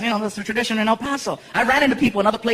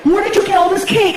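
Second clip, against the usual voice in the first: much higher, loud outraged question; girlfriend's shrill demand; 10 semitones higher, 5 dB louder than usual. Retold or imitated. Girlfriend confronting him at 3am, high and outraged. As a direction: play the angry girlfriend.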